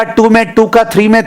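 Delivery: ordinary talk, nothing marked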